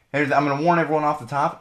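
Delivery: usual speaking voice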